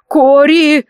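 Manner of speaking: высокий громкий оклик по имени; окликни подругу криком. A narrator's reading. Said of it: "кричит мне Фок". (радостный крик)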